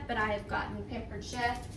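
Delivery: ordinary delivery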